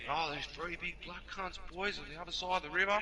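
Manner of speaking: high-pitched mock eyewitness report; breathless alibi. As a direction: spin the lie with wide-eyed urgency. Replaying their lie to the firemen.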